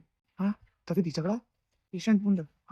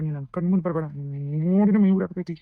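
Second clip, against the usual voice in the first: loud, seething rejection; furious; 4 dB louder than usual. Acted. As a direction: push her away in anger. Brother furious at sister.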